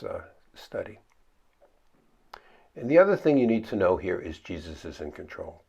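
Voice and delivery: ordinary talk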